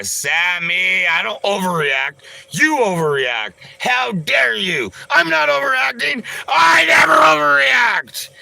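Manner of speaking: rising to a high, loud wailing yell; mock outrage. Streamer hamming up indignation at Sammy, building to a shrieking yell. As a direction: overreact on purpose while denying it.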